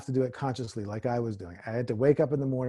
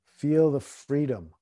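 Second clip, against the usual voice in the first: guided meditation; 3 semitones lower, 4 dB quieter than usual. Acted. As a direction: ease them into stillness. Meditation guidance.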